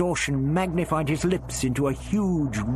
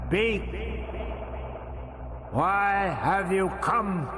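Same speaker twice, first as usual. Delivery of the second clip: booming alien voice; 9 semitones higher than usual. A narrator's reading. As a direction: boom down at the prisoner. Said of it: "The voice boomed".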